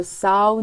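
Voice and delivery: saw misread as "sau"; wrong pronunciation. Shown as a demonstration. Teacher repeating what it is not, saw as "sau". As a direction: saw with an ow glide, like "cow". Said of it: "saw نیست".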